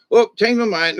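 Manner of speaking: put-on high, loud, bubbly child voice; little girl. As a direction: chirp it like a fickle four-year-old. Child switches her choice.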